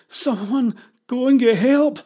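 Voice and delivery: slow, high, loud cry for help; desperate plea. Acted. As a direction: shout for anyone to help. Jenny calls for help.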